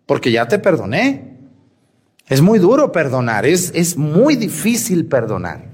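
normal talking voice

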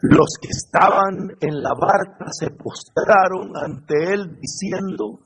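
ordinary talk, nothing marked

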